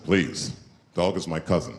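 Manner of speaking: a lightly voiced solemn Native elder; stoic Native man. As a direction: play it solemn and unbothered.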